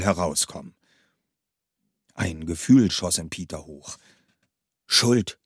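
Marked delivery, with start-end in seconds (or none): none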